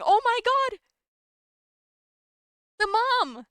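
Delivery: high, loud exclamation; stunned recognition. Real, not acted. Player works out the character is someone's mom, stunned. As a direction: cry out as the connection clicks.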